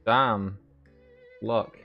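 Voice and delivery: ordinary talk, nothing marked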